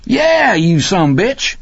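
high, slow, drawn-out confirmation; gleeful high holler; jubilant. A narrator's reading. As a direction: crow it to an old pal. Rocky joyfully confirms who he is.

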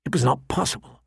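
ordinary delivery

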